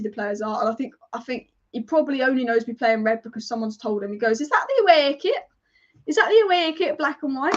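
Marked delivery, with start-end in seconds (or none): 4.4-7.5 high-pitched mock-naive question; put-on clueless questioner, pitched up; mock clueless owner. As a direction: imitate a puzzled owner who knows nothing, voice lifting. Imagined clueless owner asking.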